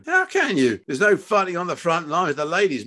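high, scoffing question; exasperated father arguing; incredulous challenge. Acted. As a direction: challenge your son scornfully.

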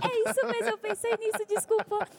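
high-pitched, laughing self-defence. Real, not acted. Singer owns up to her name pun, laughing and high-pitched. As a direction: squeal it out through laughter.

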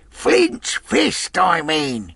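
high, exasperated shout; cursing yell, starting very high; impatient cry. A narrator's reading. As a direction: snap it loudly in fury. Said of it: "he cried".

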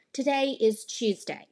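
British-style accent, Tuesday as "Chooseday"; different accent. Shown as a demonstration. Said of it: "someone else might say something like".

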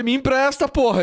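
high excited begging; pleading. Retold or imitated. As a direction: beg loudly and eagerly. Retold plea, much higher.

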